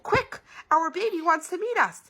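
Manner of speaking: very high, loud alarm; urgent. A narrator's reading. Mommy urgently announces the baby's coming.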